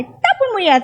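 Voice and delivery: angry doctor. Retold or imitated. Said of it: "Galit na galit sa akin".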